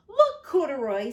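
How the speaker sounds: loud, high eager call; excited call. Acted. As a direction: call out in delight. Lisa excited at cookies.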